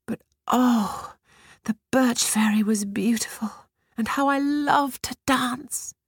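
put-on high, dreamy whispered girl's voice; wistful girl. A narrator's reading. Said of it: "she said".

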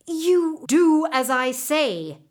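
slow, loud command. A narrator's reading. Horse Terror ordering the potter, slow and loud. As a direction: lay down the law, slowly. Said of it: "Terror commanded".

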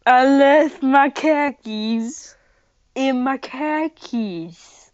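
slow and deliberate, word by word; slow, spelled out. Shown as a demonstration. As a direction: spell it out slowly for someone who didn't get it. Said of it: "I don't even know how to comprehend that".

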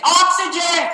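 high, hammed-up call of OXYGEN; mock exclamation. Shown as a demonstration. Teacher shows how not to order oxygen, hammed-up cry. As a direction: call out OXYGEN far too dramatically. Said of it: "I'm not gonna say it like that".

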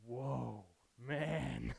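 a touch of a stunned surfer dude; lightly voiced awestruck Adam; Adam waking up. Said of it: "you know what he says?".

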